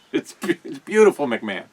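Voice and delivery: usual speaking voice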